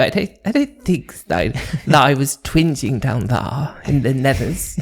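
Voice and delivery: hammed-up upper-class voice; put-on posh voice. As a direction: play a plummy gent. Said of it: "I could actually do the whole podcast like this".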